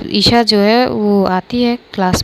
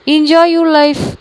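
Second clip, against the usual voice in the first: "enjoy your life" drawn out very slowly, higher and louder; sarcastic jab; 6 semitones higher, 4 dB louder, much slower than usual. Acted. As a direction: stretch "enjoy" out mockingly. Bitter parting jab. (व्यंग्य से बहुत धीरे खींचकर "enjoy your life")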